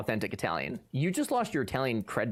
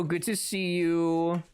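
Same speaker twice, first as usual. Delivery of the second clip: slow greeting; 3 semitones higher, much slower than usual. Real, not acted. Greeting chat slowly.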